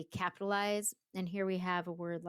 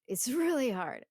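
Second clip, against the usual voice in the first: louder and higher, a strained cry; anguished outburst; 6 semitones higher, 6 dB louder than usual. Shown as a demonstration. Teacher voicing the poet, a loud strained outcry. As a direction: burst out with the strain of it. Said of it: "Going back and forth".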